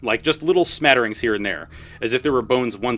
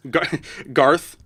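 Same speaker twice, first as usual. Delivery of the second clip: ordinary delivery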